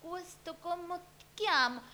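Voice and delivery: high, slow, put-on Italian voice; Wanda Nara in Italian. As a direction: coo it in dreamy Italian like Wanda. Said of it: "Wanda Nara hablando en italiano". (imitación de Wanda hablando en italiano, aguda y lenta)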